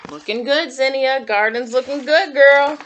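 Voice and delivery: root for her like a proud fan; cheering. Real, not acted. Cheering the character on.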